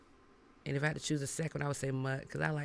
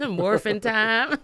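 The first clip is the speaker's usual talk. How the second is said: 1 laughing catchphrase; 7 semitones higher, 9 dB louder, slower than usual. Real, not acted.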